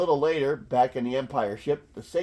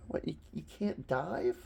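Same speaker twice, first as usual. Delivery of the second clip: ordinary talk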